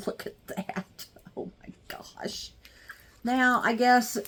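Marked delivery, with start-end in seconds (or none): 0.1-2.5 softer, breathless whisper of wonder; awed whisper. Real, not acted. Admiring the glowing light pad, an awed whisper. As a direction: whisper it in awe.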